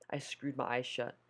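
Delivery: ordinary delivery, nothing marked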